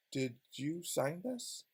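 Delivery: low, soft mock prosecutor question; a quiet, slow, sly prosecutor voice. Prosecutor quietly grilling the witness, mock sly.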